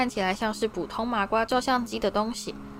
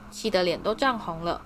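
ordinary delivery

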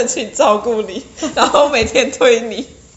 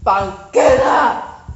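shouting; 5 dB louder, much slower than usual. Real, not acted. Calls out to play music.

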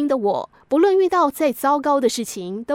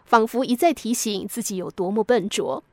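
ordinary delivery

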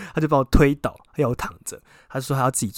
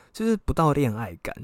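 ordinary talk, nothing marked

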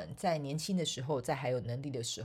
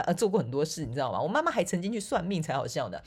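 ordinary talk, nothing marked

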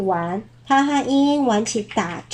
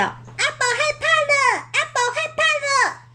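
极高的妖怪尖嗓，带笑; 怪声怪气地起哄; 尖声怪叫. Acted. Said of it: "对阿宝尖声怪叫". (小妖怪对阿宝尖声怪叫取笑)

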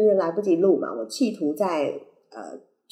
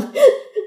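bursts out laughing at herself; big embarrassed laugh; loud laugh; 8 dB louder than usual. Real, not acted. Laughs at own mistake.

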